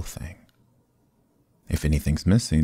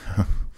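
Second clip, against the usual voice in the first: short amused laugh; 3 semitones higher than usual. Acted. Rogue laughs at chief's remark.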